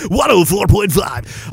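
ordinary delivery

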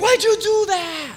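high, loud, drawn-out wail; hammed-up outraged scream; mock screamed protest. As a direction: shriek it slowly like a shaken passenger. Acts out terrified passengers' protest.

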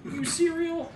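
high, goofy mock teen voice; dopey teen voice. Narrator mocking the confused worker in a high goofy voice. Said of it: "like kids say".

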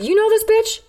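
very high, incredulous query; retold indignant question. Woman asking the random dude who stepped in, high indignant question. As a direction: shoot the question up high, acting out disbelief. Demanding answer from bystander, retold.

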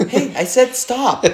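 high, slow, laughing mock order; mock stern referee. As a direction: play a ref whose order is ignored. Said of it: "acting the referee".

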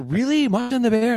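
very high squeal of disbelief; thrilled disbelief. Retold or imitated. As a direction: squeal like you can't believe it. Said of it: "They're like".

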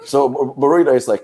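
usual speaking voice

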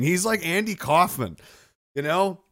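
ordinary talk

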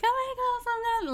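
very high mock party chatter; squealing party guests. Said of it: "dinner party talking".

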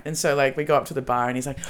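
usual speaking voice

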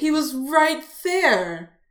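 loud, high, stretched-out wail; exclaimed dismay. Retold or imitated. Fan retelling how she missed someone right beside her, loud dismay. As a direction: wail it slowly, hammed-up.

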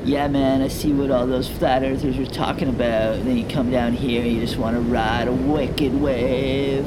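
loud laid-back surfer bro; surfer dude. Acted. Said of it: "the surfer voices".

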